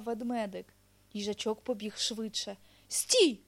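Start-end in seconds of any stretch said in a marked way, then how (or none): 2.9-3.4 high, loud, sudden halt call; shouted command. A narrator's reading. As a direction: bear calling a sharp stop. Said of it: "крикнув ведмедик".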